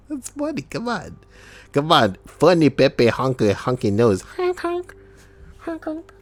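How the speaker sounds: very high, squeaky honking clown voice; silly clown. Pretend. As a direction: play a goofy honking clown. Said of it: "he's all like, he's like".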